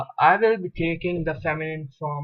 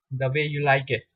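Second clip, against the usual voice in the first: ordinary delivery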